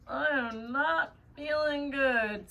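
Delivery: slow, drawn-out deadpan comedian quote; [name] impression. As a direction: drag out not feeling good. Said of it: "I was just thinking of [name]".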